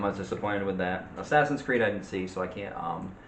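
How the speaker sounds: ordinary talk, nothing marked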